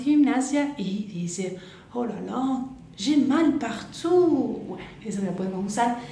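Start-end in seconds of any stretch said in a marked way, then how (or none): 1.9-4.6 higher, drawn-out mock moan of soreness; hammed-up achy lament; aching complaint. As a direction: groan it slowly like sore muscles after exercise. Acting someone sore after gym.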